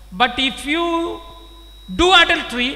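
rising to a loud warning cry; thundering preacher warning. Real, not acted. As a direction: hit it hard as a warning.

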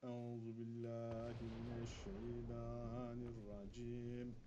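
very slow, soft, drawn-out recitation; slow murmured prayer. Real, not acted. Gamer praying against the ghost, slow soft murmur. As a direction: murmur the prayer slowly for protection. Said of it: "prayer against the devil".

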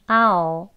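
"아오", I'll with the ee glide dropped; reduced I'll, "아오"; one step reduced. Shown as a demonstration. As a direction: say I'll as "아오", just ah plus dark l. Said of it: "E도 줄여서 그냥 아오".

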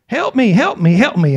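high-pitched mock plea, dropping by the end; imitated pleading cry. Preacher voicing the ignored blind men, imitated pleading cry. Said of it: "they're crying and saying".

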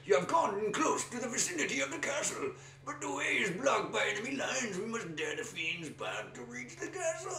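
high, grand quest narrator; game narrator voice. Acted. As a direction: announce the quest like an epic narrator. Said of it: "we're putting on voices".

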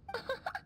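high playful giggle. Acted. Mother laughs in message.

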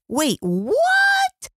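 sudden high-pitched surprise; startled. Real, not acted. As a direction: jump up in disbelief. Host reacts to creepy caller line.

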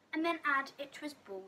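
ordinary delivery

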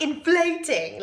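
ordinary talk

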